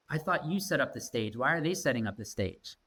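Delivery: ordinary talk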